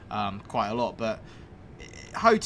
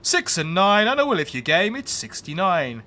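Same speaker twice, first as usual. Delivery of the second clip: loud, higher, drawn-out bingo calls; bingo caller; 4 semitones higher, 6 dB louder than usual. Pretend. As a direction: belt it like a bingo hall caller. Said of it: "I would love to be a bingo caller".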